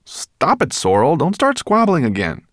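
high, loud telling-off; exasperated scolding. A narrator's reading. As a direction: cut in sharply to stop the squabble. Ben scolds squabbling Sorrel.

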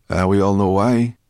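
rough, grudging mutter at full voice; growled. A narrator's reading. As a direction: growl it with disdain. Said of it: "growled Sorrel".

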